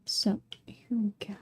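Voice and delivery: soft unvoiced whisper. Real, not acted. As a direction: breathe it out under your breath. Quiet aside while holding it.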